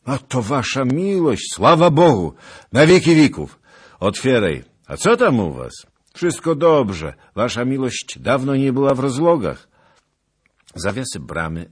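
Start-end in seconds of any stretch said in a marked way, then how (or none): 0.0-9.7 calling across the gate. Acted.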